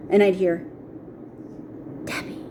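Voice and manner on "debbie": hushed, whispery call of a name; eerie whispered name. Retold or imitated. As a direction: whisper it like someone hidden in a bush. Voice from a bush calling her name.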